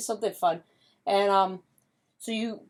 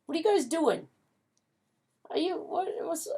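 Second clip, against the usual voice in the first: rising higher with each curious question; a nosy dog sniffing around, getting more excited; lightly voiced puzzled pup, climbing in pitch; 8 semitones higher than usual. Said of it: "the dogs will come over and they're curious".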